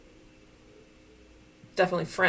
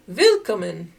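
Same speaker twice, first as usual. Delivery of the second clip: loud greeting; 13 dB louder, slower than usual. Pretend. Reading the German welcome sign to viewers, loud.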